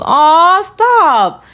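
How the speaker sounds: high, loud gushing; flattered squeal. Real, not acted. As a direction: gush like a compliment just landed. Touched by a kind comment.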